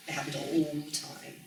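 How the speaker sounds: ordinary delivery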